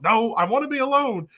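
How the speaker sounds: high, pouty sulk; mock sulking. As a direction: play the sulking announcer. Retold Cole sulking in car.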